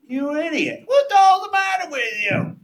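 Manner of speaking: high, loud furious yell from a colleague; scolding shout. Retold or imitated. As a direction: tear into him like a horrified friend. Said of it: "she went up one side of me, down the other".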